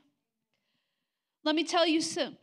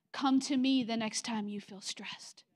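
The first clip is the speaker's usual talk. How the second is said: whispered invitation; 5 semitones lower, 5 dB quieter than usual. Retold or imitated. Repeated line, whispered.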